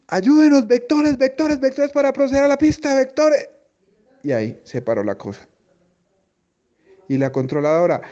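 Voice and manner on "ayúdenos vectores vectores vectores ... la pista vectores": much higher, pleading imitation of a frantic pilot; desperate pilot. Pilot begging controller for vectors, desperate high voice. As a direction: beg for vectors like a pilot in panic. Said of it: "así la voz desespera".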